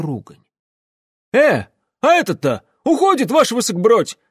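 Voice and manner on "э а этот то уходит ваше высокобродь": very high, loud warning; alarmed calling out. Acted. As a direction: soldier alerting his officer to an escape. Soldier raises alarm about escape. (тревожный крик солдата)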